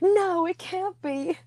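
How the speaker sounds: high, loud cry of mock horror; aghast. Friends retelling their horror at the reveal, aghast. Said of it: "we were aghast".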